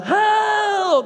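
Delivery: high, loud drawn-out shout; friends shouting hell. Retold or imitated. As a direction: greet him by yelling it across the room. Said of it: "my friends would just shout".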